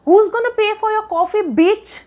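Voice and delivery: high, slow, outraged rhetorical jab; indignant outburst. Real, not acted. To the vlog audience, after he skips the bill: indignant. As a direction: let the disbelief spill out, stretching each word. Outraged he left without paying.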